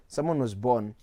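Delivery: ordinary talk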